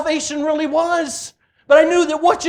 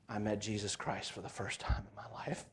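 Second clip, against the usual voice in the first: hushed whisper; 23 dB quieter than usual. Real, not acted. Preacher telling the congregation of meeting Jesus, in a hushed whisper. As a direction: breathe it, almost no voice. Climax of conversion testimony.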